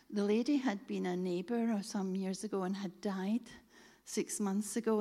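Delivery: usual speaking voice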